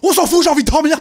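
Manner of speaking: high, loud protest; exasperated outburst. Acted. Animator protesting he just wants to sleep, exasperated. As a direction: burst out, fed up and high-pitched. Acts out his exhausted outburst.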